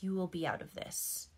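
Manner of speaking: ordinary delivery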